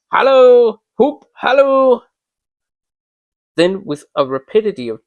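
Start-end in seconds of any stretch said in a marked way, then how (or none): 0.1-2.0 loud, higher calls; excited calling out. A narrator's reading. Scrooge calling to Friday running away, loud excited calling. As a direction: shout after him across the creek.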